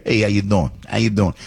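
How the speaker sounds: quick North Jersey street greeting; lightly put-on North Jersey accent. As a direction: toss it out like a North Jersey local. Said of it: "That's more North Jersey".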